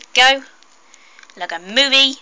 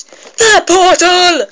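slow, high, loud thrilled cry; losing my mind; 8 semitones higher, 10 dB louder, much slower than usual. Real, not acted. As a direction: stretch it out in giddy awe. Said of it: "I am very excited for this".

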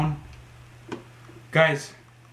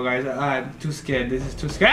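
ordinary talk, nothing marked

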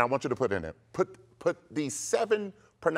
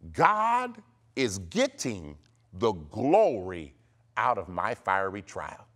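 very slow, emphatic decree; declaration to repeat; 5 semitones lower, slower than usual. Shown as a demonstration. Preacher giving listeners the final decree, declaring. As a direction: proclaim it word by word, easing at the end. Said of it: "say this".

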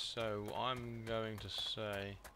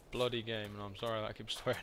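ordinary talk, nothing marked

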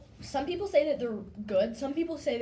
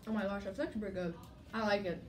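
words between bites; chewing while talking; 3 semitones lower, much faster than usual. Real, not acted. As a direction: mouth partly full of food. Seen 0: Bites snack at 1.1s, then speaks with jaw working.

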